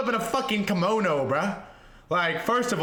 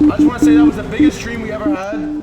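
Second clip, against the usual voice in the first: much louder, higher yell; loud hype shout; 8 semitones higher, 11 dB louder than usual. Real, not acted. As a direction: belt it to the whole chat. Final sign-off, hyped.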